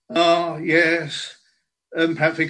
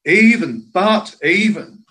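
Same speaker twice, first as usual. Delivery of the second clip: "Even" hit loud, then "but even" slow and weighted; emphatic even; 4 dB louder, slower than usual. Real, not acted. Preacher emphasizing the Lord's word, emphatic "even". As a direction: slowly drive home "even" twice. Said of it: "emphasizes it in an even greater way".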